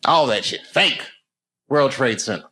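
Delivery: ordinary delivery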